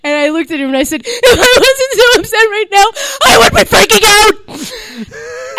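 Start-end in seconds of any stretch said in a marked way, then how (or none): 1.1-5.6 loud, high-pitched comic sobbing; acted crying. Crying fan tells her rock idol, hammed-up tearful wail. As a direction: wail it through exaggerated tears. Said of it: "like, crying".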